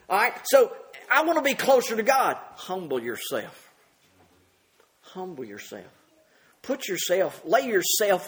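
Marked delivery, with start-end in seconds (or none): 2.6-5.9 soft repeated plea. Real, not acted. Preacher repeating a soft exhortation.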